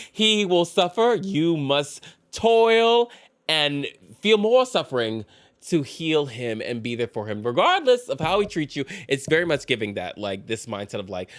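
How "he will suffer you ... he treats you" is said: high, slow, solemn mock sermon; mock preachy lesson. Mocking what girls are taught, solemn and pitched up. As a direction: preach it like a grim duty, drawn out. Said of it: "young girls to be like".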